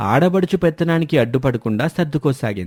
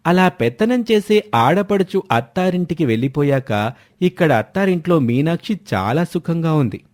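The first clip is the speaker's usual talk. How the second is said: ordinary delivery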